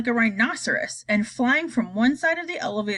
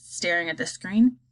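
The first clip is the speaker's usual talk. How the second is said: plain reading voice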